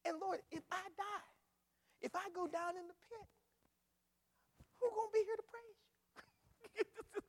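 high-pitched, halting appeal; rising plea, spaced by pauses; pleading with God. Acted. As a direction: reason with the Lord in a high voice. Psalmist bargaining with God, paraphrased.

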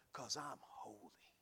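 low, slow whisper; hushed reverence. Acted. Preacher as God declaring holiness, hushed and slow. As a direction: drop to a solemn, drawn-out hush.

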